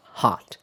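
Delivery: hot said with open ah, jaw wide; American hot. Shown as a demonstration. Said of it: "in most varieties of American English, the vowel is more open".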